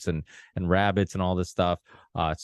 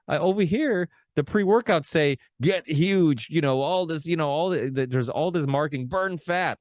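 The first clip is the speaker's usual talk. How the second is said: mocking slogans; 9 semitones higher than usual. Mocking supplement marketing slogans.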